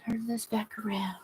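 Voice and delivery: unvoiced murmur under her breath; whispered to herself. Real, not acted. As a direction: quietly whisper it to yourself. Muttering to herself, turning camera.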